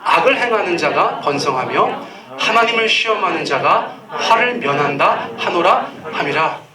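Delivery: slow, measured scripture reading together; read slowly. Shown as a demonstration. Pastor reading scripture slowly with the congregation. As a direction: let each phrase sink in, unhurried. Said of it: "요거 천천히 읽겠어요".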